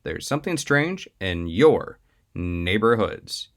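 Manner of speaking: lively announcer intro over music; podcast intro read. Acted. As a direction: sell the show like a host opening.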